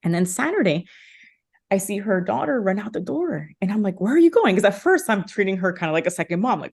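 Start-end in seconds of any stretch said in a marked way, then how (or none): 4.0-4.5 sharp high call after someone leaving. Retold or imitated. Calling after the daughter running out the door, a sudden loud call. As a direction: shout it after her as she runs out the door.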